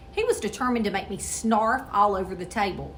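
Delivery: plain reading voice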